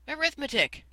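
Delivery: high, loud, eager shout; children shouting out the answer. Acted. Children shouting the subject to their teacher, high and loud. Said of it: "shouted the children".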